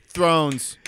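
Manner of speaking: loud mock Boston accent; exaggerated, loud Boston twang. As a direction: belt it out in a put-on Boston accent. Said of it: "like, a Boston accent".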